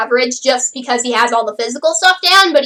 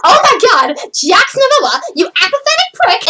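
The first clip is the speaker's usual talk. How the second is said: emphatic, higher-pitched exasperated outburst; fully in character; 7 semitones higher than usual. Shown as a demonstration. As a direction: deliver the insult with full expressive feeling. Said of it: "sound like he understands the basic emotion".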